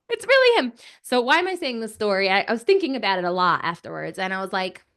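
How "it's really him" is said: amazed excitement. Retold or imitated. Acting out group's amazed reply.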